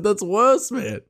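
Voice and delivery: high, loud laughing punchline; laughing retort. Retold or imitated. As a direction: burst out laughing through the line. Retelling his retort, laughing.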